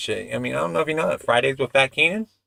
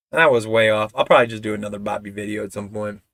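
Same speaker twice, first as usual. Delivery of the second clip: ordinary talk, nothing marked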